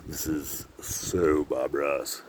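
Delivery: low and soft with a long drawn-out "so"; slow, savoured murmur. Real, not acted. Vlogger savouring the painterly view, slow murmur. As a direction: stretch "so" right out, quietly. Repeats it slowly while admiring scenery.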